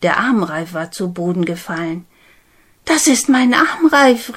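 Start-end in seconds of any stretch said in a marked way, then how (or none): 2.8-4.3 higher, louder startled exclamation; surprised cry. Acted. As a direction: recognise the bracelet with a start. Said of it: "rief Namarona aus".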